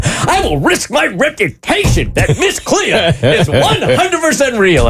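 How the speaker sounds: slow, high, solemn vow; grand TV pledge. Retold or imitated. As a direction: overblown declaration. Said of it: "I think I even said".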